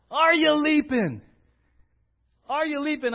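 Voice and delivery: bright rising challenge; eager leaping call. Shown as a demonstration. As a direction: lift it up like a jump of joy. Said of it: "Are you creeping or leaping?".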